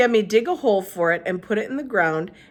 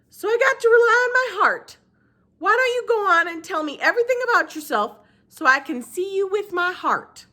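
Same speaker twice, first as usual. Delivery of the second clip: Gloria Dump voice; 6 dB louder than usual. Acted. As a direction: speak up high and unhurried.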